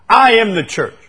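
high, loud mock of a proud boast; loud, high, self-important mock quote; mocking a smug claim. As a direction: say it like a smug churchgoer. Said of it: "you know what I hear all the time".

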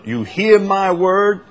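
HEAR drawn out high, pressing on MY WORD; emphatic quote. Retold or imitated. Preacher reminding listeners of the Lord's emphasis, emphatic. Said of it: "remind you of the Lord's emphasis".

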